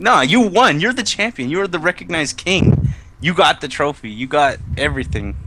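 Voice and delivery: emphatic correction. Acted. Telling a doubting champion they won, emphatic insistence. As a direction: lift the pitch and set them straight.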